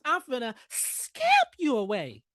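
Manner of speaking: mock high, slow voice. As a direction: stretch it out up high. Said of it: "I did that in my high voice".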